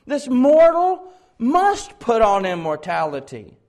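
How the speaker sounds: "must" stretched high and very loud; slow proclamation leaning hard on "must"; stress on MUST. Acted. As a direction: hammer "must" at full preaching force. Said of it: "notice his emphasis there on must".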